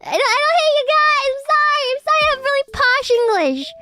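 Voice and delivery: high squeaky mock apology; high cartoon voice apologising; put-on voice.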